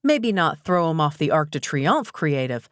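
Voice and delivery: ordinary delivery, nothing marked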